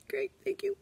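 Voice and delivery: tiny, very high, soft squeak; holding pain in. Retold or imitated. Teacher thanking principal with hurt nose, high pained squeak. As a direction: squeeze it out while suppressing pain. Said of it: "Trying to hold it all in".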